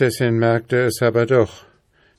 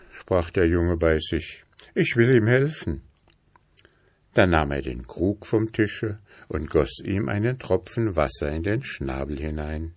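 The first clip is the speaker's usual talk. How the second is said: ordinary delivery, nothing marked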